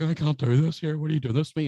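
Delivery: hammed-up tearful protest; mock crying. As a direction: whine it like someone cracking up. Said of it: "he started crying".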